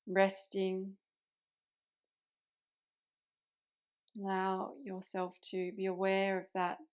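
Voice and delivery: calm meditation guide with long pauses between phrases; unhurried, settled voice, easing listeners into rest; guided meditation. Acted. Yoga teacher guiding listeners into Yoga Nidra, calm guided meditation.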